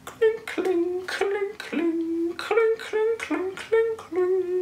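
high tinkling kling sounds; high bell chime. Pretend.

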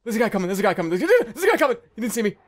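high, rushed, panicky repetition; real alarm. Real, not acted. Gamer sees a guy coming, panicked alarm. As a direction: blurt out a warning, rising in panic. Spots someone coming while hiding.

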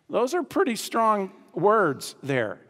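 usual speaking voice

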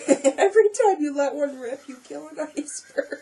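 high giggly delivery. Real, not acted. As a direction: tell it while giggling. First telling of joke.

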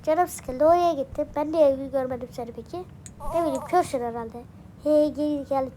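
ordinary talk, nothing marked

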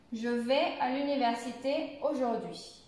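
statement tune falling on AUJOURD'HUI; affirmative intonation. Shown as a demonstration. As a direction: let AUJOURD'HUI drop at the end, as a plain statement. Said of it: "on va commencer avec la phrase affirmative".

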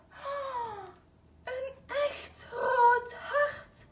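high, slow, near-tearful wonder; delighted. A narrator's reading. Little bear sees the red heart appear, overjoyed. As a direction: marvel at it, voice catching.

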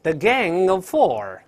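The Gang of Four said very slowly, stress on Four; slow model read. Shown as a demonstration. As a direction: spell out The Gang of Four word by word. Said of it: "咱们看看下边是哪个团伙". (老师慢速领读The Gang of Four)

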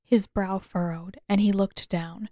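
plain reading voice

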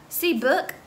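put-on high, rising little-kid question; toddler asking. Fudge asks Mrs Yarby to look, eager toddler voice.

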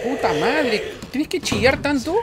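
high loud angry yelling; furious yelling. Real, not acted. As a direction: scream at the dog in fury. Shouting at attacking dog.

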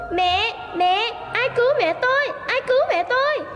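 high anguished calls, no sob heard; crying out. A narrator's reading. Boy repeating his cry for his mother. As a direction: plead for help. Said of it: "khóc thét".

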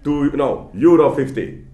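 mock sly seller closing the deal; market seller. Lecturer plays the vendor naming his price, hammed-up and eager. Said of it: "there is this guy who says".

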